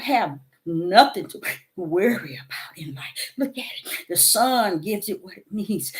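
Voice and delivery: hushed, teary prayer at the tree, partly whispered; a quiet, retold prayer of sorrow; tearful whispered prayer. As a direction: softly pour out a sad prayer, near tears. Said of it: "I was crying".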